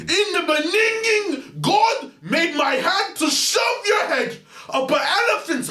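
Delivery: high, slow shrieked sermon; screaming proclamation. Acted. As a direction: preach it at a shriek.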